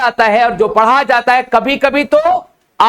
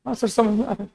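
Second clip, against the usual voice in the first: much softer, drained mumble; limp, lifeless student; 10 dB quieter, faster than usual. Retold or imitated. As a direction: sound hopeless and half asleep. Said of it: "हताश, निराश, अस्सी साल के बुड्ढे". (थका हारा, बुझी आवाज़ में छात्र)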